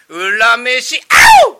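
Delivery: loud, very high [name] style shriek; Neverland ghost. Shown as a demonstration. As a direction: shout then yelp high like [name]. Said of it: "ネバーランドの言葉でやれって言われたの".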